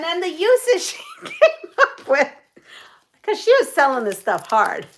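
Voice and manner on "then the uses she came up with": laughing through the words, higher pitched; cracking up. Real, not acted. Recalling the glove's uses for viewers, cracking up. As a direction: say it while cracking up. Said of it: "I'm cracking up".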